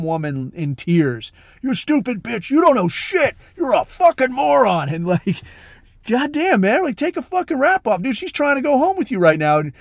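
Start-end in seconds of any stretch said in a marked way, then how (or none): 1.6-4.9 much higher, louder mock yelling; imitated angry rant. Bipolar guy insulting woman. 6.1-9.6 mock exasperated plea. Friends scold bipolar guy.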